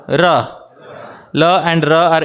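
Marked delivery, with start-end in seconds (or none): 0.1-0.6 Ra spoken plainly as a model sound; teaching Ra. Shown as a demonstration. Teacher modelling Ra for devotees to repeat. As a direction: say Ra with a tapped R, for the crowd to echo. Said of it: "Please repeat after me".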